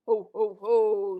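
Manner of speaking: Santa's ho ho ho. A narrator's reading. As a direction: drop low and boom it out. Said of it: "Santa said".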